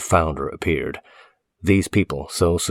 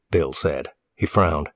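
plain reading voice

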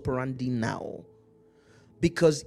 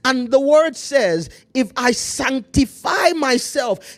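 fiery preaching; 4 semitones higher, 5 dB louder than usual. Acted. Preaching at full force.

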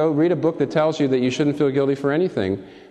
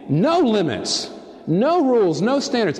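put-on higher, slow, whiny child chant; 10 semitones higher than usual. Preacher mimicking a child demanding no limits, higher and whiny.

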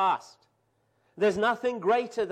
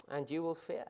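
quiet warning; 2 semitones lower, 10 dB quieter than usual. Acted. Refrain dropped low.

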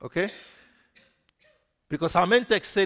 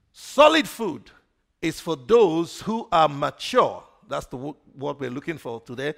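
usual speaking voice

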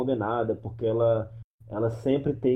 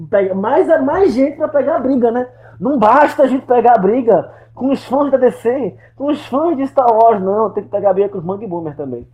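mock outrage; 11 semitones higher, 9 dB louder than usual. Mock outrage at picking fights.

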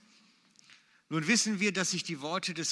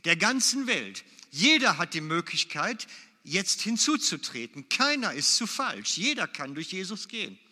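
loud, emphatic pulpit proclamation; a preacher raising his voice to drive the point in; preaching at full force; 5 semitones higher, 9 dB louder than usual. Acted. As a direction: hammer each phrase home to the congregation. Preaching climax, everyone may enter.

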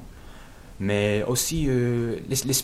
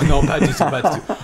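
en riant; 6 semitones higher, 10 dB louder than usual. Real, not acted. Rit en niant tout problème.

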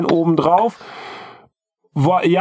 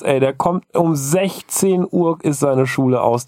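usual speaking voice

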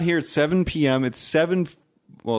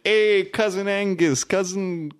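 lightly Scottish, higher, slow; mock Scottish greeting; 6 semitones higher, slower than usual. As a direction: greet each cousin in a hammy Scottish lilt. Impersonating Highlander greeting cousins.

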